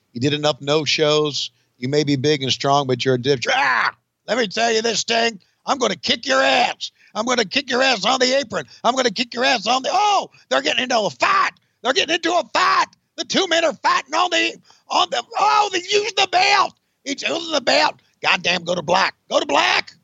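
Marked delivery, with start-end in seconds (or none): 3.5-9.9 high, hammed-up threatening wrestler; Sid Vicious impression. [name] as Sid threatening Sting. 9.9-19.9 excited announcer. Pretend. [name] as announcer calling the brawl, excited. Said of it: "Sorry, I got so excited there".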